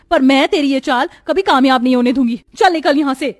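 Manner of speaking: loud, high-pitched angry tirade; furious wife. Acted. Wife accusing her friend of chasing her husband, furious. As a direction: lash out loudly at a betraying friend.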